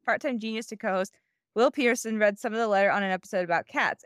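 usual speaking voice

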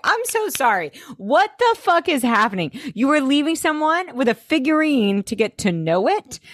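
high-pitched baffled protest; comic incredulity, pitched high; incredulous. Retold or imitated. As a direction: question it in high, emphatic disbelief. Host's incredulous reaction to scene.

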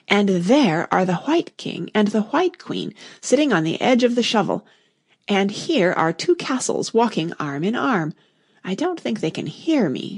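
plain reading voice

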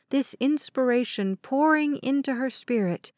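plain reading voice

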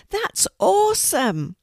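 high, thrilled exclamation; excited, high-voiced young boy; Beckett exclaiming. A narrator's reading. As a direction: let it soar high in amazement. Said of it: "exclaimed Beckett".

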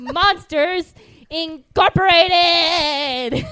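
loud, hugely drawn-out movie announcement; hyped title reveal. Real, not acted. Host revealing the next banger movie, booming announcement. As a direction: bellow the title, stretching every syllable.

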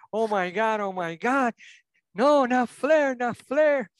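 high, slow anguished cry of no; Bobby Heenan yelling. Retold or imitated. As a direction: wail it like a heartbroken commentator. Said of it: "Bobby Heenan grita en el cielo".

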